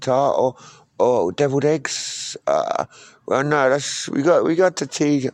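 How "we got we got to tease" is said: ordinary talk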